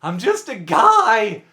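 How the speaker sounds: loud, stretched cry on "guy"; exasperated plea. Real, not acted. Pleading with viewers over the debate, exasperated cry. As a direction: plead it loudly and slowly.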